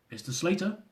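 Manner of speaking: put-on questioning Indian accent on Slater; thick Indian accent. Immigration officer checking the name in a thick Indian accent. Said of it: "with a thick Indian accent".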